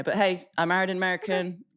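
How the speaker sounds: ordinary talk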